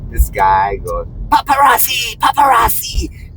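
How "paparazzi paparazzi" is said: high mock yell; mock scream. Market guy yelling paparazzi at me, high mock yell. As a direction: scream it at the camera, hammed-up. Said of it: "He's screaming like heck".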